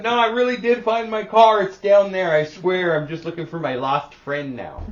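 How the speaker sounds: loud, high, pleading protest; defensive insistence. Retold or imitated. To the staring crowd, loud anxious protest. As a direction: insist you really found your car. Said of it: "I wanna be like".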